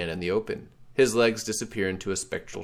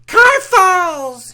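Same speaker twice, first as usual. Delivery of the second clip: very high, loud goblin shriek; guttural goblin scream; 14 dB louder than usual. Acted. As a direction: scream it like a goblin leader to the crowd. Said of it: "screams in his guttural voice".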